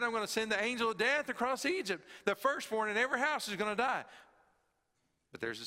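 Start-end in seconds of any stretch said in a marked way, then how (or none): 0.0-4.0 higher, louder and fast, a proclamation; booming voice of God. Acted. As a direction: announce the coming judgement with force. Voicing God's proclamation.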